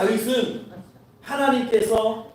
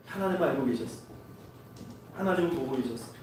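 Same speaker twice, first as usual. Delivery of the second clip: hushed solemn; 5 semitones lower, 11 dB quieter, faster than usual. Acted. Solemn point about God knowing.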